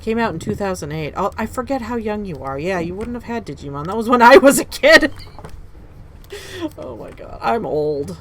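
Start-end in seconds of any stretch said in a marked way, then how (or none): 5.2-6.7 breathy laugh. Real, not acted. Laughing at being old.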